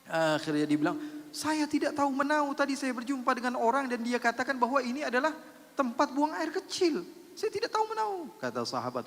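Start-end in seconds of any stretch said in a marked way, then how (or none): 1.3-8.3 higher pleading voice of a blind stranger; a lightly voiced, anxious blind man; flustered blind man. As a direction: protest your innocence, pitched up.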